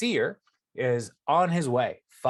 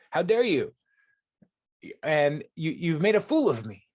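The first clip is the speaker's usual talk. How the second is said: higher-pitched, mock-indignant outburst; raised-pitch retold anger, affronted; angry retort; 4 semitones higher, 3 dB louder than usual. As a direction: act out an indignant rider scolding his donkey. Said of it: "And his anger is so mu".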